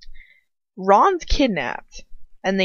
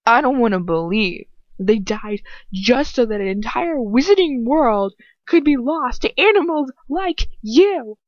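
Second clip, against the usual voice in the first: mild shout, rising louder and higher toward the end; 5 semitones higher, 3 dB louder than usual. A narrator's reading. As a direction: read it as a half-hearted shouted retort. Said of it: "I shout at him".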